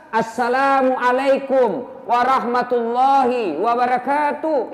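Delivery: "Assalamualaikum warahmatullahi wabarakatuh" slowly, every syllable clear; slow full salam. Shown as a demonstration. Preacher showing the congregation the proper salam, slow and clear. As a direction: lay the full greeting out carefully.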